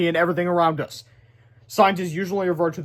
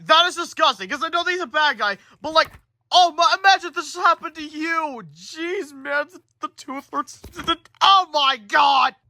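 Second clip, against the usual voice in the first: high-pitched disgusted rant; grossed out; 11 semitones higher than usual. Real, not acted. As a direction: squirm at the gross picture. Reader disgusted by picture.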